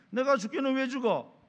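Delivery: angry retort. Retold or imitated. Man on phone with friend, furious shout. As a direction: fire it back, offended and defiant. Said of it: "화가 나는 거예요".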